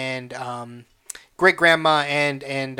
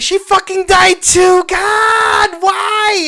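high, loud wailing protest; mock crying; 13 semitones higher, 8 dB louder, slower than usual. Viewer lamenting that Mama Coco died too, mock-anguished wail. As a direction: cry out in hammed-up anguish. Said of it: "And I'm crying again".

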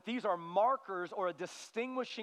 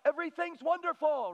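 mock cheerfulness; 6 semitones higher, 3 dB louder than usual. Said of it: "I'm not talking about crazy".

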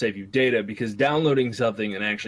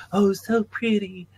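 swooning mock admirer; 7 semitones higher, slower than usual. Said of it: "which was like".

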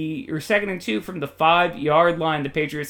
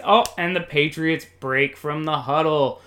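ordinary talk, nothing marked

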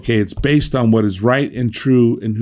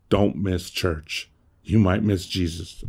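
1 quiet joke aside; 5 semitones lower, 7 dB quieter than usual. Shown as a demonstration.

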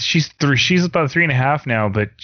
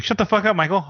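cheerful and higher, relishing it; jovial; 6 semitones higher, faster than usual. Retold or imitated. Fan gleefully telling Michael to shut up, jovial. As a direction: say it like you enjoy every word. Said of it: "this sounds super jovial when he says it".

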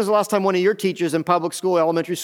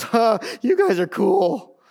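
high, slow gushing fan imitation; mock a tongue-tied fan, drawn out and pitched up; imitating starstruck wife; 4 semitones higher, slower than usual. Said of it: "Ruth just goes".

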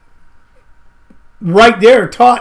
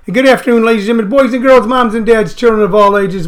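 ringmaster; 3 semitones higher than usual. Acted. Show host opening like ringmaster.

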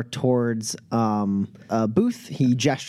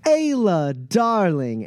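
higher-pitched, drawn-out darling welcome; effusive greeting; 7 semitones higher, slower than usual. Acted. Pep spotting Elkiri at the cantina entrance, effusive. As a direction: greet her with showy affection. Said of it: "sees Elkiri and goes".

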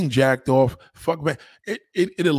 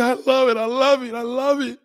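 much higher, louder gushing repeats; hammed-up high gushing woman; mock gushing woman; 11 semitones higher, 4 dB louder than usual. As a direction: squeal it like an eager lover. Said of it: "And they'll tell you".

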